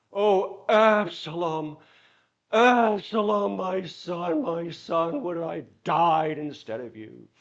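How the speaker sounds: hammed-up wailing cry, loud and high; overdone dramatic priest. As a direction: overact it like a frustrated actor in the pulpit. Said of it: "but he always overdid it".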